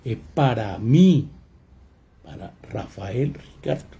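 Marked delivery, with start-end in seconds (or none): 0.0-1.3 slow phrase landing loud on "mí"; deliberate, strong accent on "mí"; stressed pronoun MÍ. Shown as a demonstration. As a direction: lean hard on the final "mí". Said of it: "El segundo me reemplaza".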